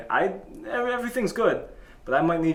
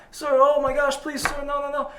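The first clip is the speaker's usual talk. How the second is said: high-pitched mock panic; panicked pleading; 12 semitones higher than usual. Counter kid begs the customer not to fight, panicked. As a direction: imitate a scared kid begging, voice way up.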